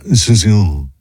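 Asuncion in a French accent, ending cut off, as susi; French-accented Asuncion, end dropped; French pronunciation. Shown as a demonstration. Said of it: "they don't say the end of the word".

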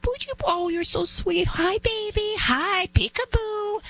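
high-pitched cooing, stretched at the end; sweet, very high voice for talking to babies; baby talk. Acted. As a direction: coo at a baby in a high singsong. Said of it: "when you talk to a toddler".

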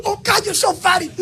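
imitating crying fan. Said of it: "She goes".

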